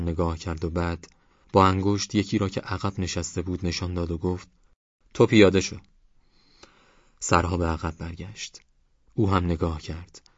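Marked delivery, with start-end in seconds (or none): 5.1-5.8 a louder, sharper order to get off; stern command. A narrator's reading. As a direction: snap the order at the old man. Angry driver orders passenger off. (دستور تند و بلند)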